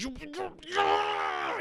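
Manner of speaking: stuttering build into a long high roar; very mad. Retold or imitated. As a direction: sputter with hammed-up rage, then bellow. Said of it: "he gets very mad".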